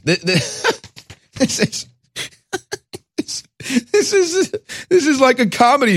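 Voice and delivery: stammering through real laughter; cracking up, can barely get the words out; disbelieving giggle breaking the sentence; laughing. Real, not acted. Host cracking up at blackface story.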